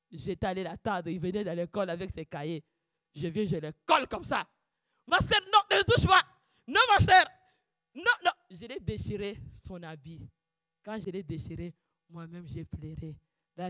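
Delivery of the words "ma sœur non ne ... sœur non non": high, loud mock refusal; retold fiancé's protest; imitating the fiancé. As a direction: push her away in his voice.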